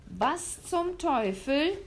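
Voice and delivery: a hunter seething at the sight; spat out. Acted. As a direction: spit it out like a furious hunter. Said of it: "spie der Jäger".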